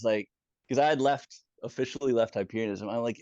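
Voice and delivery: ordinary delivery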